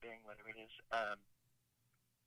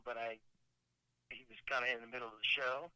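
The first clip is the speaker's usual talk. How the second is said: usual speaking voice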